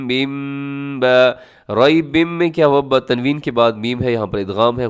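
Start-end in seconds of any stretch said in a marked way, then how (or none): 0.1-1.4 बिम ब held long through the nose; बिम ब with a long ghunna; nasal ghunna. Shown as a demonstration. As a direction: hum बिम into ब slowly. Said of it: "गुन्ने के साथ आपने पढ़ना है".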